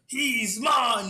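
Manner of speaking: high, loud shrieked claim; girl's scream. Retold or imitated. The swinging girl claiming the man, shouted high and loud. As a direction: belt it out like a wild girl.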